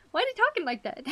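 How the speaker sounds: high-pitched, amused puzzlement; high mock-puzzled question; retold bafflement. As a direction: act out your own baffled reaction. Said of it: "I was just like".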